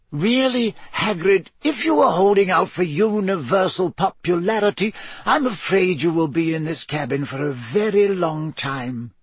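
put-on lightly amused elderly wizard; Dumbledore voice. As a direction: play the wise old headmaster, gently teasing.

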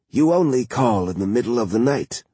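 drowsy, just woken by the phone; groggy, half-asleep murmur; sleepy voice. A narrator's reading. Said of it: "In a sleepy voice".

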